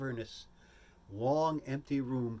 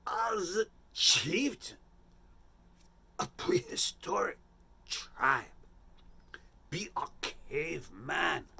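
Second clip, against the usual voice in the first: director's voice; 4 semitones higher, much slower than usual. Retold or imitated. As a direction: lay out each word like a theatrical director. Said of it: "He says".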